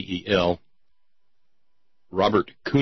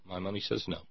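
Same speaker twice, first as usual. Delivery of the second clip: usual speaking voice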